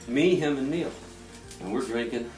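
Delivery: ordinary talk, nothing marked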